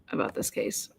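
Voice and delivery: hoarse, voice almost gone; lost voice, barely a raspy whisper. Real, not acted. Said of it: "God, I have no voice".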